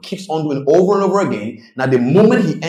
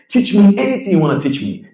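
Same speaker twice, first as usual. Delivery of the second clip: eager student; 9 semitones higher, 3 dB louder than usual. Retold or imitated.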